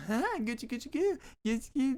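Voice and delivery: put-on high sing-song baby talk; baby-talk coo.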